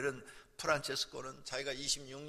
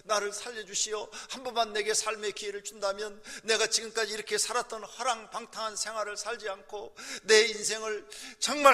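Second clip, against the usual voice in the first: higher and louder, begging for his life; a dead man begging the angel to let him live, raised and pleading; desperate pleading; 8 semitones higher, 8 dB louder than usual. Retold or imitated. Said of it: "막 간청을 합니다".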